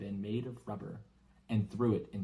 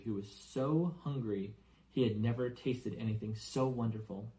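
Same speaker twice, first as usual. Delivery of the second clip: plain reading voice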